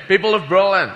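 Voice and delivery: put-on Connery accent slipping to plain; Scottish accent fading; a faded Scottish accent; lost Connery accent. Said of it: "I've lost my fucking accent now".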